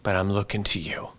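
soft, hushed trust, fading to a whisper; whispered prayer. Retold or imitated. Pastor praying for the congregation's surrender, hushed whisper. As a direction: breathe the prayer out quietly. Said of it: "and say".

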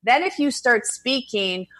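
normal talking voice

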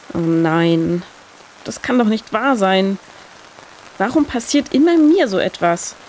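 usual speaking voice

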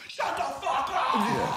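high, drawn-out, laughing yell of disbelief; mock scream. A starstruck kid screams while running laps around the bar. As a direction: holler it while sprinting round the bar.

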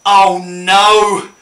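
loud, drawn-out cry of dismay. Pretend. Finds Gilbert has swallowed it, dismayed. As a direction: wail it out in mock horror.

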